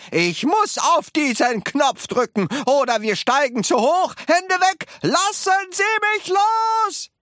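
high, urgent yelling that climbs; roaring. A narrator's reading. Wonka needing to press the button, roaring. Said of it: "brüllte Mister Wonka".

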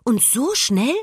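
hoch und ungläubig nachfragen; staunend. A narrator's reading. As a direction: staune mit steigender Stimme. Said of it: "staunte Emma". (verblüffte Frage)

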